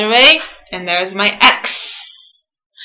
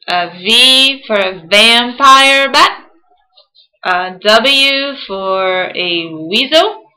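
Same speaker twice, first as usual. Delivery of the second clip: slow; much slower than usual. Shown as a demonstration. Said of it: "We gotta say it slowly".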